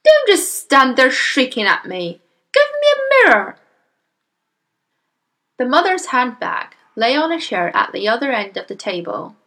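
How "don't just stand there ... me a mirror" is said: loud demand climbing to a very high yell; yelling father. Acted. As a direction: build to a frantic shout. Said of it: "the father yelled".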